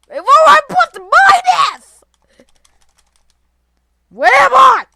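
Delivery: high, very loud yelling; panicked shout. Real, not acted. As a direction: scream in confused panic. Panicked confusion in game.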